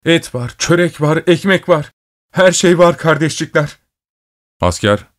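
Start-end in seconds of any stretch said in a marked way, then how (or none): 0.0-3.8 high, joyful announcement; delighted, high-pitched calling out; ringing with joy. A narrator's reading. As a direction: ring it out gleefully, pitch way up. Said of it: "Öğrencinin sesi sevinçle çınladı".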